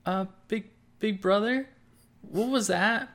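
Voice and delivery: put-on high, timid child voice; a small boy frightened by a growl; scared little kid. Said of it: "Gus turns to Starrick and says".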